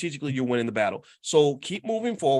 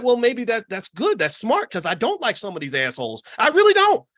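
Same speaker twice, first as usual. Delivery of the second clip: defiant retort building to a shout; 7 semitones higher, 6 dB louder than usual. Retold or imitated.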